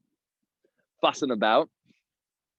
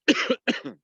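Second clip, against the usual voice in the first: sharp high cough; coughing fit; 14 semitones higher than usual. Real, not acted. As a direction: cough out the bug. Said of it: "Swallowed a little gnat".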